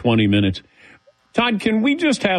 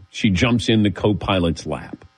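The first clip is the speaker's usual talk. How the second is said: ordinary delivery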